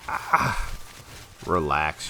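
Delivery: ordinary talk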